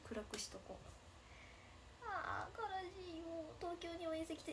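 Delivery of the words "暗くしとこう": hushed aside. Pretend. Sad joke about dimming.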